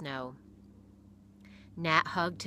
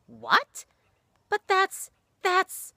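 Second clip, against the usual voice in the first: much higher, louder, stunned break-off; shocked; 10 dB louder, much slower than usual. A narrator's reading. Pastor hearing the boy quit school, shocked. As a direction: stop dead in disbelief.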